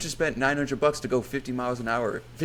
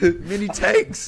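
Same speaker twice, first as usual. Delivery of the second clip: excited; 10 semitones higher, 7 dB louder, much slower than usual. Real, not acted. Excited weapon fantasy.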